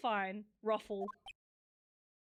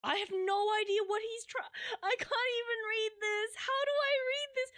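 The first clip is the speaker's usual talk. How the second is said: high whiny wail of confusion; half-laughing, half-crying complaint; exasperated whine; 8 semitones higher than usual. Real, not acted. Baffled by unreadable game text.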